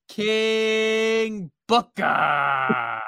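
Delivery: slow, drawn-out announcer call; mock ring announcer. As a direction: stretch the name out like introducing a wrestler. Mock announcing of a wrestling match.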